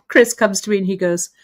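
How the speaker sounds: ordinary delivery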